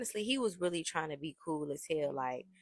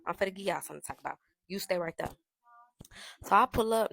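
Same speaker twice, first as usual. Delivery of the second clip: ordinary talk, nothing marked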